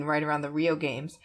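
ordinary delivery

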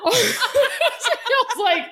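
high, slow laughing through pain. Real, not acted.

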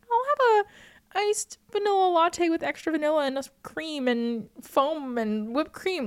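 put-on high, girlish ordering voice; self-mocking sweet order. Mocks her own sugary coffee order.

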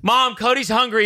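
put-on high-pitched tattling kid; kid calling Mom. Kid telling Mom a friend is hungry, tattling call.

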